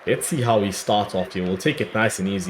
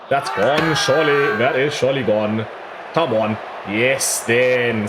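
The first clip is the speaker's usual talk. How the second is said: higher and louder, elated; excited cry; 3 semitones higher, 4 dB louder than usual. Real, not acted. Gamer celebrating a catch, excited louder cry. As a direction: call it out as the wicket falls. Wicket appeal, he exults.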